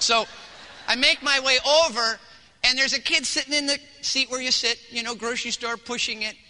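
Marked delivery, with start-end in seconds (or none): none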